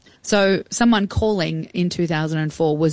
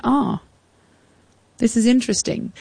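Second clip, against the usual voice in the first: intrigued; 6 semitones higher than usual. Retold or imitated. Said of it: "for me, I was like".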